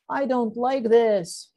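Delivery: high, loud, slow complaint; scared. A narrator's reading. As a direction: whine it high and drawn out, frightened. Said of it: "He's scared".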